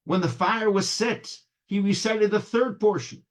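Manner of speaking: high, lifted storytelling climax; animated, building narration; rising joy. A narrator's reading. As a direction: lift the pitch as the story mounts. Story climax, prayer growing joyful.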